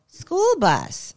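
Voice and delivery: put-on high toddler voice naming a bus. Son reading his truck book, high childish naming. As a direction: chirp it like a small boy. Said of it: "he'd go".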